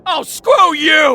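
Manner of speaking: slow, high, furious; drunken fury at a friend; angry shout. Acted. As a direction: spit it out in high-pitched disgust. Drunk Tommy rejecting Jason.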